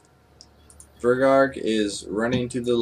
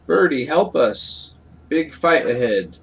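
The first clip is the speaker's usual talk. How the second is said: louder, higher simple fighter; loud plea from a simple brute; Fergarg the broken-speech character; 4 semitones higher, 5 dB louder than usual. Acted. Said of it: "Fergarg is going to be like".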